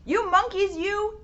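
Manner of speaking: scolding peddler. Acted. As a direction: tell off the monkeys, voice lifted. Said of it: "he said, shaking his finger".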